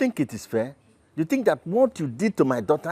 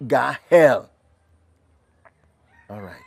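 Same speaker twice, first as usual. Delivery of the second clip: normal talking voice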